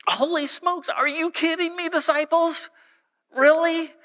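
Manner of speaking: high-pitched mock disbelief; exasperated, high-voiced disbelief; incredulous. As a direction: react in hammed-up astonishment, pitch way up.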